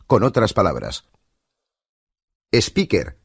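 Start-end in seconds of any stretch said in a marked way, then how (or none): 2.5-3.2 SPEAKER as "es-PI-ker"; Spanish accent. Shown as a demonstration. Instructor compares for learners, Spanish accent. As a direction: say SPEAKER with leading e and sounded r. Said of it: "entre la pronunciación española y".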